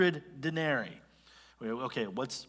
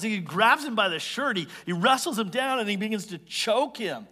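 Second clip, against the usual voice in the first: ordinary delivery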